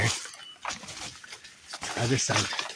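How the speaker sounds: whispering. Real, not acted. Quiet aside in the woods.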